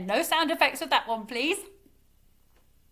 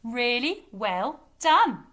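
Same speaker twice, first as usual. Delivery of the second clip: ordinary delivery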